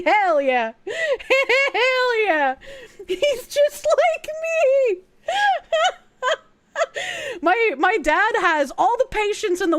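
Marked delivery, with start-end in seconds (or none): 0.0-2.5 cheering. Retold or imitated. Cheering as happy dad. 3.1-7.4 slow, high, laughing words; laughing while telling about dad. Real, not acted.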